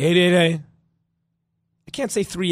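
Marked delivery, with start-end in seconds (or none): 0.0-0.6 Eight eight eight slurred lazily, loud, last t dropped; Eight eight eight run together, louder; lazy eight eight eight. Shown as a demonstration. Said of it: "Like, lazy".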